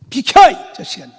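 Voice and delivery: retold furious order; angry command. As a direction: shove them aside with your voice. Retold shoving past the doorkeeper.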